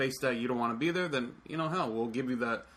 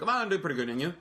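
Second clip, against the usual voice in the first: rattled off fast and a bit louder; fast mumbled small-talk answer; rushed reply; 4 semitones higher, 4 dB louder, faster than usual. Shown as a demonstration. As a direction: say it all in one quick breath. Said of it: "I say it really quick".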